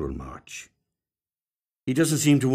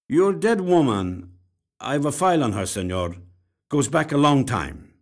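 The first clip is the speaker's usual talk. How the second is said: lightly voiced detective, weighing each word; 5 semitones higher, 4 dB louder than usual. A narrator's reading. Detective, higher, louder, slow start.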